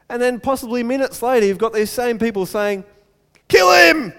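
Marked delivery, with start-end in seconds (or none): 3.5-4.1 high, loud acted yell; sudden full-voiced demand for blood; mob shout. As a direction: cry out as a hostile crowd. Crowd turns on Paul.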